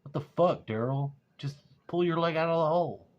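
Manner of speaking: acted-out what-the-hell telling-off; exasperated scolding. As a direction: scold a stuck friend in disbelief. Retells scolding his stuck friend.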